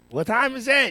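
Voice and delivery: high, loud demand for the time; called-out question. Real, not acted. Host repeats his question to the crew, loud and high. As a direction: belt the question out across the studio.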